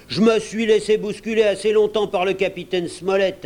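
high, indignant complaint; heated mutinous pirate; rebellious grumble. Acted. Pirate rebels against being bossed.

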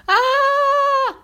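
piercing high-pitched shriek; high scream. Acted. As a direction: shriek loud and high as she flees. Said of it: "s'enfuit à toutes jambes en criant". (cri perçant très aigu)

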